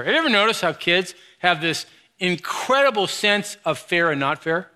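ordinary talk, nothing marked

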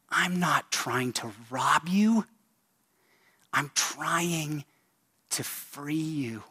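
slow wizard's rebuke, easing from forceful to hushed; Gandalf. Acted. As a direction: open commandingly, drawing out the words, then soften into gentle pleading. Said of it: "do my best Gandalf impression".

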